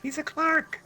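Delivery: "He's a clerk." said high and soft; dismissive high. Acted. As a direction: sneer it out lightly. Mocking line about the chronicler.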